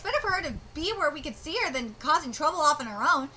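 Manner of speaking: usual speaking voice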